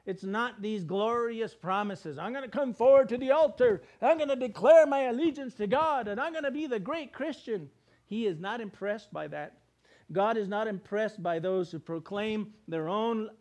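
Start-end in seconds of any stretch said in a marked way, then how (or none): 2.2-7.7 loud, higher mock boast; mock proud vow. Preacher mocking a boastful believer, loud mock vow. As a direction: puff up like a showy convert.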